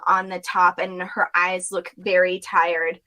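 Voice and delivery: normal talking voice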